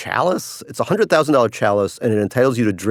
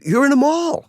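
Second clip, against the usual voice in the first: mock surprise; 14 semitones higher than usual. Real, not acted. Punchline about cruise ship.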